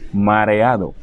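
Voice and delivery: ordinary delivery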